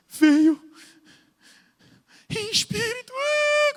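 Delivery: high wailing crying voice, breaking. Retold or imitated. Possessed girl twisting the words into a high wail. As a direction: let the words climb into a sobbing wail. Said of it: "começou a chorar".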